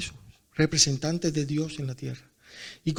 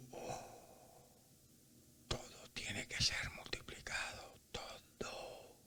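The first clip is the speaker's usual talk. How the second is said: breathy whispered voice of God; 23 dB quieter, slower than usual. Retold or imitated. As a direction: hush it to a secret whisper. God's voice whispered in retold talk.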